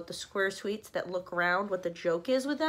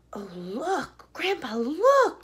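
high, slow, louder excited call to grandpa; excited cry; 7 semitones higher, 6 dB louder, much slower than usual. Acted. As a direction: call out in wonder. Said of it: "cried Charlie".

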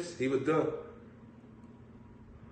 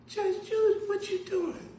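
very high squealing fan voice, laughing; squealing old lady. Retold or imitated. Old lady thinking she met Judge Judy, squealing. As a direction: squeal like she can't believe it. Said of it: "the lady said".

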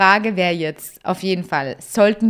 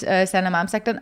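ordinary talk

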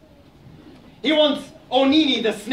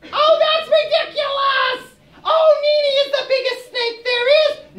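much higher, loud indignant squeak; squeaky hedgehog; 4 dB louder than usual. Acted. Hedgehog argues with Aso, a high indignant squeak. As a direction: protest in a tiny outraged voice.

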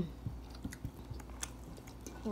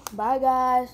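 cheerful goodbye; 4 semitones higher, 7 dB louder than usual. Real, not acted. Cheerful sign-off.

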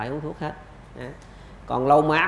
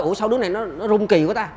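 higher-pitched, mock puzzled wondering; puzzled surprise; 5 semitones higher, 4 dB louder than usual. Doctor acting out someone noticing a shivering child, puzzled. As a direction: voice someone baffled by what they see.

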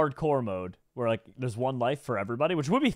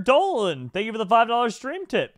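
excited; 9 semitones higher, 5 dB louder than usual. Real, not acted. Thanking a donor on stream.

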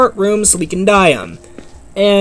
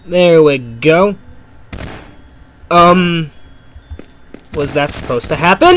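usual speaking voice

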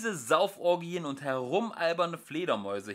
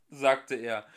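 ordinary delivery

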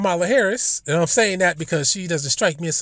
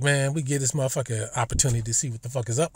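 softer, fast, lower grumble; grumbling agents; 5 semitones lower, 7 dB quieter, faster than usual. Retold or imitated. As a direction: gripe quickly under your breath. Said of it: "So they're probably like".

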